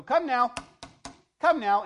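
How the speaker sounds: loud high wake-up call; preacher bellowing come now; booming call. Retold or imitated. Preacher jolting a drowsy congregation, loud call. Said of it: "The speaker would go".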